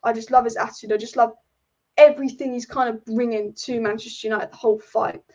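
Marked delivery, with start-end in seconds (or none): none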